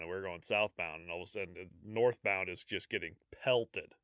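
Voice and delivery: usual speaking voice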